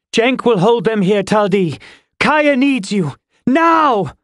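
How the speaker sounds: urgent command. A narrator's reading. Lynn urging wounded fighter toward Kaya, urgently shouting. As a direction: press the order, climbing to a yell on Now. Urgent order in battle, voiced character.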